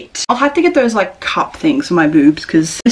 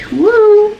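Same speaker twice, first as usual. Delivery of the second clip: high, louder playful woo; 12 semitones higher, 4 dB louder than usual. Pretend. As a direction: toss off a silly high woo. Mimics flimsy fabric with sound.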